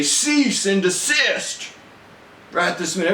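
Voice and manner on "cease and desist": slow, higher stern commanding order; military father order. Retold or imitated. Father ordering his wrestling sons, a slow stern command. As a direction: bark it like a drill officer to wrestling kids. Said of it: "my father would say".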